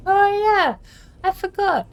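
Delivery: high, loud sudden recall; sudden realization. Real, not acted. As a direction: blurt it out as it hits you. Suddenly remembers mid-game.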